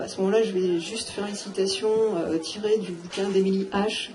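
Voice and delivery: ordinary talk, nothing marked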